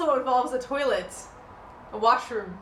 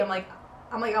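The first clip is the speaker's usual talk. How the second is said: ordinary talk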